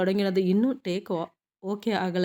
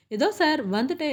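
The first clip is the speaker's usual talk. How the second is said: higher-pitched, eager-to-obey answer; startled, obedient reply; 6 semitones higher than usual. Acted. Actress answering the director's call as she gets up, startled reply. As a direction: answer the boss's summons at once, pitched up.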